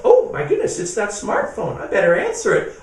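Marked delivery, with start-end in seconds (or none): 0.0-0.8 high, loud startled gasp of words. Acted. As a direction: jump at the sudden ring. Monster startled by ringing phone.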